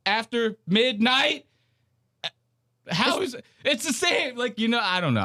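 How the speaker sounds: high-pitched, mock-exasperated argument; joking anger. Host arguing the two show names match, joking anger.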